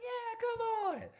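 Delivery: women casually calling him in, put-on high voice; high, light mock female voice; high-pitched invitation. Models to the guy needing the bathroom, high-pitched invitation.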